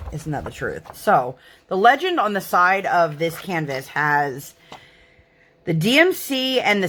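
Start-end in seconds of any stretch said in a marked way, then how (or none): none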